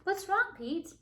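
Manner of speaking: high, concerned question; worried friend. Acted. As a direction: ask gently but worried. Callie asks worried.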